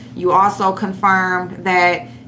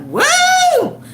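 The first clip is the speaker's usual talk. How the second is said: whooping laugh; 14 semitones higher, much slower than usual. Real, not acted. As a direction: whoop like she can't take it.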